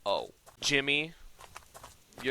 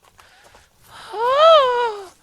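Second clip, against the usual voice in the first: very high, loud shocked gasp; 17 dB louder than usual. Real, not acted. Builder realising he built the wrong thing, horrified gasp. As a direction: gasp in horror.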